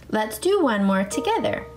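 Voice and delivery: ordinary talk, nothing marked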